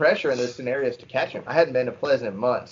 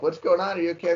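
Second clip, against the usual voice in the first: mock yell, higher pitch without real volume; pitched-up call, only half-yelled; retold yell; 6 semitones higher, faster than usual. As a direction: imitate calling out to check on them. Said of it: "I start yelling".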